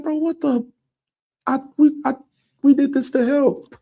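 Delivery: high-pitched, stammering mock indignation; baffled protest. Imagines Harvey's baffled protest.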